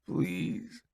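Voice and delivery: soft, faint plea; begging. A narrator's reading. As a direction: beg weakly. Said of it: "he begs".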